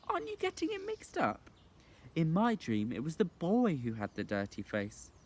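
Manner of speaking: put-on high light girl voice; a young girl, faintly teasing. Girl's voice in story.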